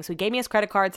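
normal talking voice

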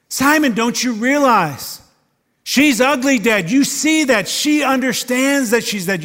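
high, loud pleading as Jesus to Simon; urgent plea. Acted.

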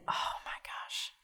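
slow, whispered oh my gosh; soft, breath-only exclamation; hushed dismay. Real, not acted. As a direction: let it out slowly under the breath. Recalling seeing Peter cry.

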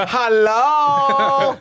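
loud, high, drawn-out "hello"; incredulous hello. Retold or imitated. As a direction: call out in mock disbelief. Mock incredulous reaction to brother's outburst.

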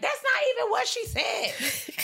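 high-pitched exasperated protest; high squeal of indignation; indignant outburst. Retold or imitated. Quoting own indignant reaction to captions.

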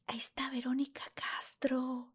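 unvoiced secret whisper; hushed urgent whisper. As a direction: whisper her name so nobody hears. Friend whispers celebrity is there.

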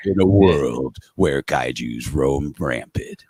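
slow, dramatic trailer announcer; movie trailer voice. Shown as a demonstration. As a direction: intone it like an epic teaser, slowly. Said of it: "movie trailer man voice".

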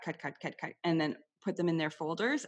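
usual speaking voice

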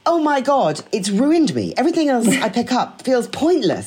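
ordinary talk, nothing marked

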